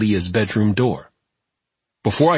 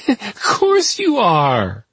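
high, slow, sniggering disbelief; snigger, then stretch the words out mockingly; 12 semitones higher, much slower than usual. A narrator's reading. Tess disbelieving the visitor at her door, sniggering. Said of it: "Tess sniggered".

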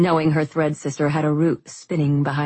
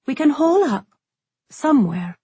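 higher, lightly voiced resigned girl; resigned young woman; 9 semitones higher, 4 dB louder than usual. A narrator's reading. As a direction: say it like hiding is the only choice. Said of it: "tone final and shoulders sagging".

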